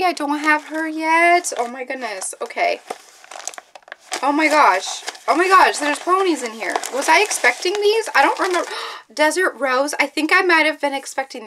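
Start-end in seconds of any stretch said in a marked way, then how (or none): none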